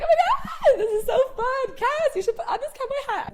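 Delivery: high, loud, giddy squeal; Dean. Retold or imitated. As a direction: gush with hammed-up joy, laughing through it. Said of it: "Dean is so happy, like, so jovial".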